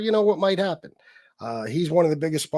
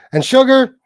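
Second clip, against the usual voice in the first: name shouted higher and louder; loud call-out; 7 semitones higher, 9 dB louder than usual. Real, not acted. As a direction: bark the name out as a threat. Warning a rival streamer.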